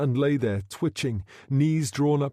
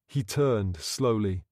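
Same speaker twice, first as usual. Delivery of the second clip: ordinary delivery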